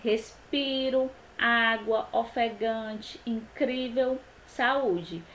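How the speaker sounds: "respiro, água, ofegante, incrível, saúde" with the penultimate syllable held; deliberate list "respiro, água, ofegante, incrível, saúde", stretching each second-last syllable; second-last syllable stressed. Shown as a demonstration. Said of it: "a sílaba tônica é a penúltima".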